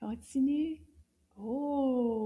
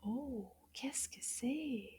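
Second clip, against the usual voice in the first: put-on hushed, secretive question to the kids; playful whispered quiz question; 11 dB quieter, slower than usual. Gasp then whispered question.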